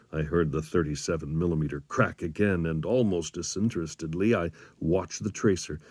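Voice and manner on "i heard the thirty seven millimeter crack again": ordinary delivery, nothing marked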